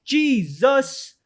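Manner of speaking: high, slow hype host voice; show host intro. Acted. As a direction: announce the show like a big opening. Opens the show as host Spider Fam.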